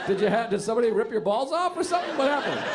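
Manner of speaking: usual speaking voice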